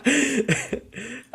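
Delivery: high-pitched amused laugh; short burst of high laughter. Real, not acted. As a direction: laugh lightly at the co-host's remark. Laughs after co-host's closing theory.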